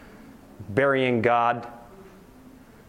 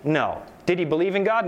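ordinary talk

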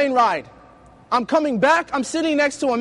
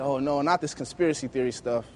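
inner groan; 10 semitones lower, 7 dB quieter than usual. Retold or imitated. Inner groan at conspiracy question.

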